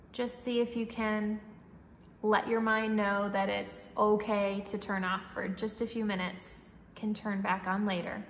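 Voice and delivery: calm, even breathing-coach voice; guided meditation. Acted. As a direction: guide the breath gently and steadily.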